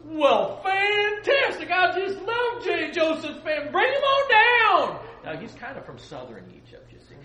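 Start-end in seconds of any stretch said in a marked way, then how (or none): 0.0-5.0 high, loud, slow booming king; pompous Pharaoh. Retold or imitated. Pharaoh welcoming Joseph's family, booming and delighted. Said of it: "And Pharaoh goes".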